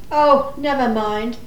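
low, soft and resigned; resigned giving up. Acted. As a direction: give up and drop the voice. Gives up after repeated mishearing.

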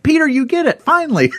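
very high, loud burst of delight; excited praise. Retold or imitated. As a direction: cry out in joy that he finally gets it. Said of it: "explodes with praise".

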